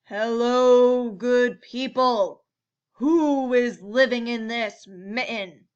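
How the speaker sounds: booming, slow, loud bear call; bellowing bear. A narrator's reading. Bear bellowing into the mitten at the animals. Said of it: "he stopped and bellowed".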